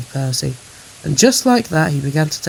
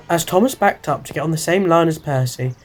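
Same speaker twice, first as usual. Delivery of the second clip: plain reading voice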